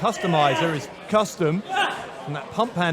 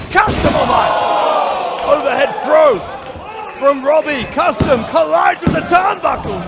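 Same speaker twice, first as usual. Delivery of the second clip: louder, higher play-by-play call; excited commentary; 8 semitones higher, 6 dB louder than usual. Real, not acted. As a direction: call the big spot with excitement. Big move during live commentary.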